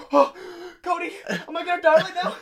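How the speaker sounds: very high, squeaky plea through laughter; mock dying panic. Doomed man pleading to Cody, mock panic.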